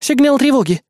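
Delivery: high, urgent warning; exclaimed alert. A narrator's reading. Assistant reporting an alarm, exclaiming. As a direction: call out the alarm. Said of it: "воскликнула Эрис".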